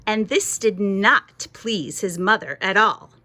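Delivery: usual speaking voice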